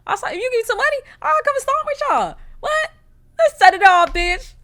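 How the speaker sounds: high, loud, whiny plea for money; hammed-up begging neighbor. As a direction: wheedle Fran for cash, overdoing it. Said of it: "I'm like".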